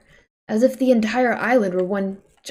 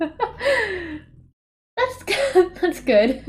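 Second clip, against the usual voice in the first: laughing; 9 semitones higher, much slower than usual. Real, not acted. Laughing at a stream moment.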